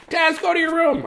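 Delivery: very high mock scolding, laughing through it. Family scolding the dog, high and giggling. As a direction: squeak it out while laughing. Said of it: "We'd be like".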